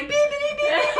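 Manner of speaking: very high, loud beeps through laughter; imitated censor beeps. Host imitating her bleeped gift video, high beeping. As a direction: squeal out a string of bleeps. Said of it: "I'm cutting that video. It's like".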